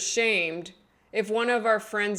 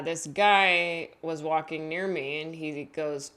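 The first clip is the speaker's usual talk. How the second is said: ordinary delivery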